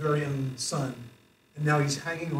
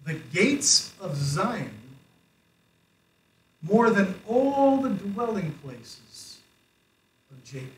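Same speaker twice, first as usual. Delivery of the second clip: scripture reading; 4 semitones higher, 4 dB louder, slower than usual. Shown as a demonstration. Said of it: "it says in verse two".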